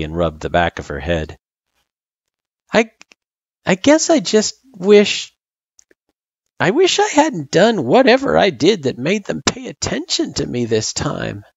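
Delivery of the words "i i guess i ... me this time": ordinary delivery, nothing marked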